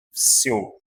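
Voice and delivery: Sio with a very weak I, almost sio; Russian sio. Shown as a demonstration. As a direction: clip the I, keep it faint. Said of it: "O V fraquinho e o I fraquinho também".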